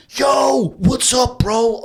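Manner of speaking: high, loud yelled hello; shouted bro greeting. Retold or imitated. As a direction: holler it up the stairs. Friend shouting greeting on stairs.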